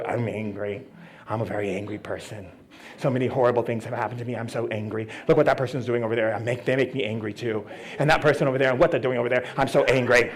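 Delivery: low, muted grumbling; mock angry person. Imitating angry people listing grievances, low mock anger. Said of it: "you know, angry people".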